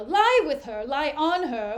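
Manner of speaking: loud, outraged outburst, pitch up; Othello's horrified shout at Iago's words; outraged cry. Acted. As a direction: blurt it out like a man who just heard the worst. Othello erupts at Iago's claim.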